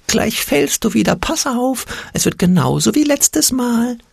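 very high, slow panicked screech; high-pitched fearful shriek, drawn out; shrieking Angstpeter. A narrator's reading. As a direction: screech it like a terrified imp. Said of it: "kreischte der Angstpeter".

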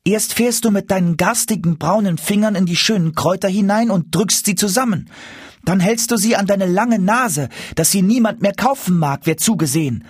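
put-on high-pitched cross little boy; indignant boy. A narrator's reading. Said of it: "rief er unmutig". (unmutig rufender kleiner Junge, hohe Stimme)

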